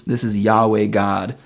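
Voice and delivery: Yahweh God drawn out slowly, each word weighted; slow down and lean on Yahweh God; slow emphasis. Acted. Podcaster stressing Yahweh over Babylonian gods, slow and weighty.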